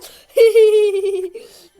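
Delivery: long loud laugh; loud laughter. Real, not acted. Lacht laut über Video.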